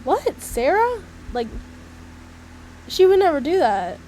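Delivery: high-pitched mock-shocked gasp of gossip; acted disbelief. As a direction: retell being scandalized, pitched right up. Acts out shocked reaction to gossip.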